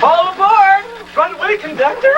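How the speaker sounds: high, excited game call. Acted. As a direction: call out like a train conductor at play. Child playing train.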